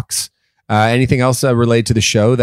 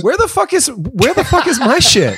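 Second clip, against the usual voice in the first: higher, hammed-up wounded outrage; mock hurt; 14 semitones higher than usual. Jealous co-host whining about missing gear, mock hurt. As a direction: play the jilted friend, half laughing. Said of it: "the hurt in your voice".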